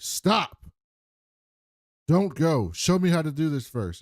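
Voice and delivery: retold plea to stop someone leaving; urgent command. Brother Dude stops her from going, urgent command. Acting out a character's urgent halt.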